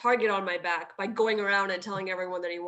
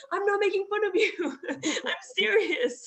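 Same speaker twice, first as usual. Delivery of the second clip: laughing protest; 10 semitones higher than usual. Retold or imitated.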